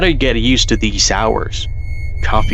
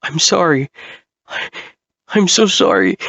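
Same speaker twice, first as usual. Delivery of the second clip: crying; 9 semitones higher than usual. Acted.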